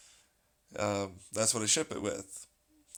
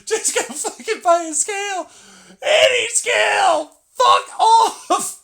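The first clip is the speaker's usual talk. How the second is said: much higher, louder, cracking laughing shout; mock furious yell; 12 dB louder, slower than usual. Yelling at angry customers to buy a scale, laughing. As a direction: scream it at the complainers, half-laughing.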